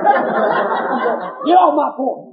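very loud, high, drawn-out bellow; mimicking angry big man. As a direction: roar them away as the soaked homeowner. Soaked man orders boys away.

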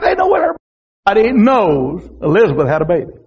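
usual speaking voice

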